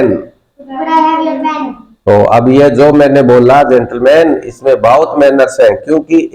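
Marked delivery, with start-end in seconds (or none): 0.0-1.7 class repeating. Real, not acted.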